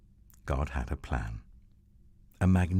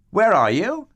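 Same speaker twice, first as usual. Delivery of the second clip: high, loud searching call; God calling for his hiding children; 10 dB louder than usual. Acted. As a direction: call out across the garden.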